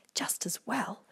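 soft unvoiced whisper. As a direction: whisper it aside. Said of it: "whispered Aurora".

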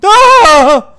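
high shrill yelp of fright; startled scream. Real, not acted. Lights cut suddenly.

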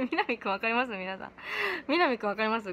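ordinary talk, nothing marked

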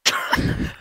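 very high shriek of laughter; squealing burst of laughter. Real, not acted.

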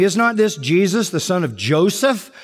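retold jeering of the doubters; mocking scorn. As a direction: ridicule him lightly, a touch of scorn. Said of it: "This is scorn, this is mockery".

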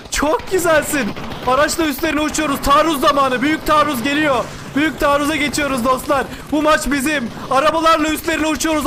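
rising esports commentary on a charge; excited play-by-play. Real, not acted. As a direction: call the counterattack with mounting excitement. Commentary as counterattack builds. (coşkulu canlı maç anlatımı)

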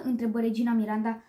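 ordinary delivery

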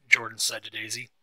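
ordinary delivery

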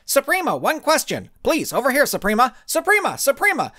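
high, eager shouts for attention; clamoring reporters. A narrator's reading. Reporters crowding a superhero, calling out her name over each other. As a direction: call out over the crowd, pushing to be heard.

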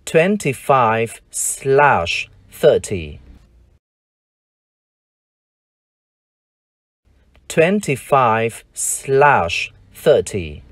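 twenty-five slash thirty, slow and careful; slow model read. Shown as a demonstration. Teacher reading an address number slowly to the class. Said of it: "Ví dụ".

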